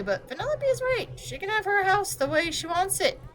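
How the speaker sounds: lightly voiced friendly character. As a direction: back the kid up kindly. Another character sides with girl.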